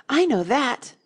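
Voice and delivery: high, loud and eager; eager pupil jumping in; eager cry. A narrator's reading. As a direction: pipe up eagerly with the answer. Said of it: "Alice cried eagerly".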